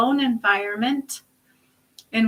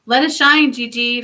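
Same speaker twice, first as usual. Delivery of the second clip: proclaiming; 9 dB louder than usual. Real, not acted. Reader to viewer, proclaiming the card's message. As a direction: ring it out like a blessing.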